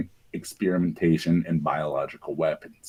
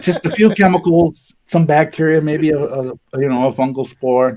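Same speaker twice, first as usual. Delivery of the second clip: mock breezy reassurance; 6 semitones higher than usual. Mocking officials, high voice.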